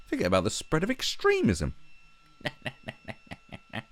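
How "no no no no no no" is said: high, laughing no no no. Real, not acted. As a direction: giggle out the refusals. Laughing mock refusal.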